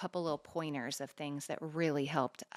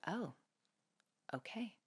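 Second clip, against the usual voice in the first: meek whisper; 4 semitones higher, 14 dB quieter than usual. Retold or imitated. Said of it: "She was like".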